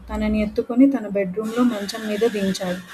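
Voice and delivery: ordinary delivery, nothing marked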